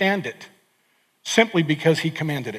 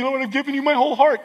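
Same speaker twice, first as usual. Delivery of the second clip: higher, fast, self-satisfied protest; mock pious protest; 7 semitones higher, faster than usual. Churchgoer insisting to God he's given enough, mock pious. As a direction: rattle it off like a smug churchgoer. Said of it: "Don't try to convince me".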